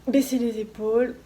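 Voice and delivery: ordinary talk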